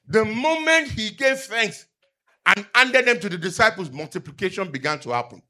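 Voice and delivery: fervent sermon delivery; preaching. Acted. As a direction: proclaim it to the congregation. Preaching the loaves story.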